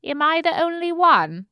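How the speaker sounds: posh British accent attempt; learner's posh British accent; posh accent try. Shown as a demonstration. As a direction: copy the plummy royal sound. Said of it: "that slight constriction in the throat".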